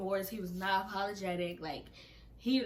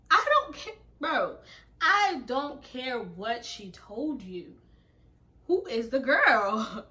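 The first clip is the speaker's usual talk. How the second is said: high, loud, drawn-out insistence; worked-up retold outburst; exasperated demand; 4 semitones higher, 8 dB louder, slower than usual. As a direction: demand it loudly, stretching the words. Demanding the girl's name from friend.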